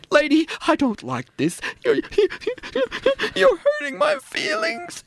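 stammer, then wail it out very high; hammed-up tearful victim; hurt wailing. Victim protests tearfully to seductress.

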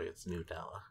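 Nutella said as new-TELL-uh, softly; soft, low Nutella with first vowel as in "new"; new pronunciation. Retold or imitated. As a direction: quietly correct with "new" at the front of Nutella. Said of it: "I just mentioned".

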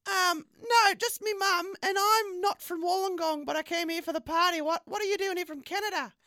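flirty woman Abatha.

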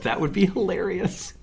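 high, soft voice through giggles; laughing. Real, not acted. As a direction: talk while cracking up. Laughing at string on AirPods idea.

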